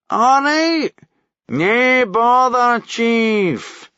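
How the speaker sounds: high, loud, drawn-out mock solemnity; slow hammed-up respectful tone; mock gravity. A narrator's reading. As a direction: play at being serious to wind him up. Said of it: "all mock gravity".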